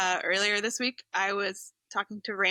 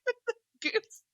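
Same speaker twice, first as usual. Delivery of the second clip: laughing through it; 7 semitones higher than usual. Retold or imitated. Recalling the bobcat scene to friends, laughing through the words. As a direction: laugh helplessly as you say it. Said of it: "laughing hysterically 'cause I'm like".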